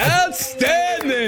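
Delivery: drawn-out high cheer; excited praise. Real, not acted. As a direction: stretch it out in delight. Praising a tongue twister.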